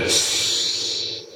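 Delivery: low, whispered ghostly call; unearthly hollow whisper from the dark; eerie ghost voice. A narrator's reading. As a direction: breathe the name like a ghost. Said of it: "eine unirdisch hallende Stimme".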